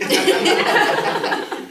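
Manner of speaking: high, loud breathy cackle; shrieking laugh. Real, not acted. Mocking the rich rival, shrieking laugh. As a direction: burst into a high wheezing laugh.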